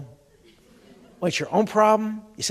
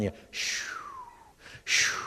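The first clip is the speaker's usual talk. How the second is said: soft unvoiced shushing of waves; ocean shush; 12 dB quieter, slower than usual. Shown as a demonstration. Speaker imitating the ocean for the audience, soft unvoiced shushing. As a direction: breathe out a long shoo like surf. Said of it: "The sound".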